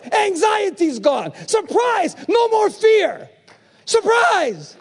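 high shouted surprises; preaching at full force. Acted. Preacher shouting surprises of grace to the church. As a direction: proclaim each surprise, building.

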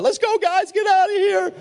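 a comic squeal to flee; mock panicked escape. Imagined Jesus urging disciples to run, squeaky mock panic. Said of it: "if he was panicking, he would have said".

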